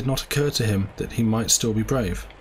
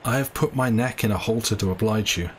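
plain reading voice

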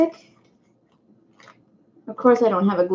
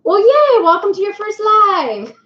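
much higher and louder, gushing yay; excited welcome; 9 semitones higher, 4 dB louder than usual. Real, not acted. Streamer welcoming Becca to her first live, gushing and high. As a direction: cheer a newcomer into the stream.

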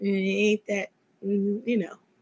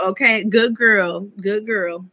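ordinary talk

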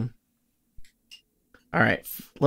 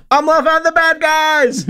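high, raised call to companions; 14 semitones higher, 4 dB louder than usual. Acted. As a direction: shout a warning back over your shoulder. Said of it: "Regdar calls over his shoulder to his coworkers".

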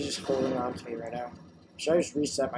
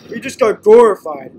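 loud excited exclaim; 6 semitones higher, 15 dB louder than usual. Real, not acted. Killed in game.

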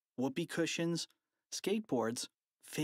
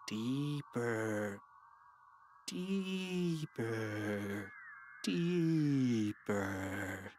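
mock hypnotist; much slower than usual. Boys hypnotizing the principal.

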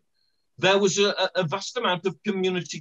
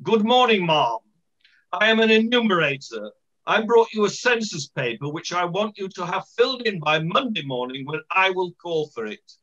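put-on posh officialdom, pitched up; formal posh accent. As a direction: play a posh census man addressing a householder. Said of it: "he said in his posh voice".

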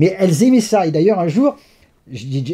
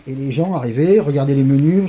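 ordinary talk, nothing marked

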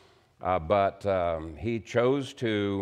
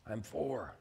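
put-on hushed little boy voice, much softer; child whispering; 3 semitones higher, 9 dB quieter than usual. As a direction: whisper like a small boy hiding. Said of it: "still whispering".

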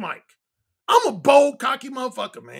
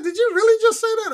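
high-pitched, mock disbelief; incredulous woman; 11 semitones higher, 4 dB louder than usual. Woman answering a man's brazen proposition, incredulous. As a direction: imitate a woman taken aback, pitched way up.

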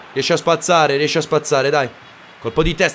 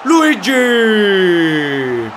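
breathless italian goal commentary; much slower than usual. Real, not acted. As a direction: stretch the name, then explode. Goal scored by his player.

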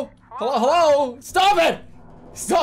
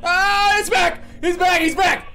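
high panicked shouting; screaming in fear; 12 dB louder, slower than usual. Real, not acted. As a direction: yell in alarm. Panic as enemy returns.

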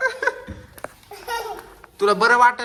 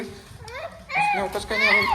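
mock echo; 4 dB quieter than usual. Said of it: "mock repeat".

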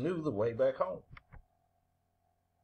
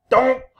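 loud, high donk; crash noise; 9 semitones higher, 12 dB louder than usual. A narrator's reading. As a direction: blurt out a sudden thud sound. Narrator imitates the crash landing.

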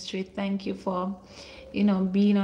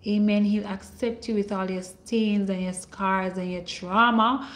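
usual speaking voice

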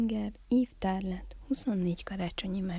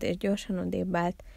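usual speaking voice

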